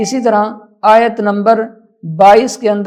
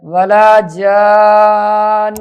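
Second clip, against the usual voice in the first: long held vowel into doubled n; Quran recitation; 4 dB louder, much slower than usual. Shown as a demonstration. Teacher reciting a phrase for learners, drawn-out recitation.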